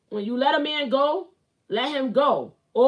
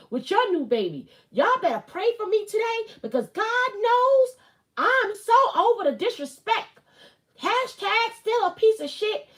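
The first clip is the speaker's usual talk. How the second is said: put-on Melody Holt accent; 7 semitones higher than usual. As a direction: read the post in an imitated accent, high and emphatic. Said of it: "the best accent I could give for Melody Holt's accent".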